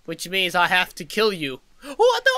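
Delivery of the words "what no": sudden high shocked yelp; shocked cry. Real, not acted. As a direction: caught off guard, cry out.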